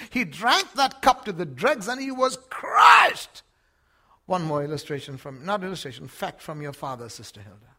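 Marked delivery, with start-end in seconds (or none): 0.1-3.4 loud, slow, anguished cry. Real, not acted. Preacher crying out to the congregation about the cross. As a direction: shout it out in anguish.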